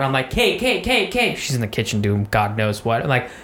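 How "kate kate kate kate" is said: high calling out to the wife. Retold or imitated. Calling wife to come from the kitchen, raised voice. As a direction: call her name from down the hall.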